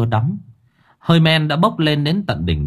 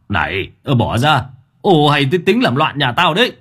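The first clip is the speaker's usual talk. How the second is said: stern shout; 3 semitones higher than usual. Acted. Master Phong shouting at the ghost to let go, stern. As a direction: bark it like a master defending his house. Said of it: "Ông thầy Phong quát".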